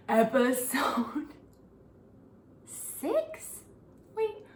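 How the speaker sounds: whispered, then a breathy laugh. Real, not acted. Reading the episode number, whispered to herself. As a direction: whisper it, barely voiced.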